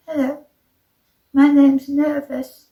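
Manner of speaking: nervous voice. A narrator's reading. Sheepish little man introducing himself, nervous. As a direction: introduce yourself timidly, pausing after hello. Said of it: "in a very nervous voice".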